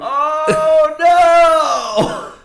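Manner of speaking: high, very loud drawn-out wail; horrified outcry. Real, not acted. Listener hears a hawk took the puppy, horrified outcry. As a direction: cry out in loud, high-pitched dismay. Shocked reaction to puppy story.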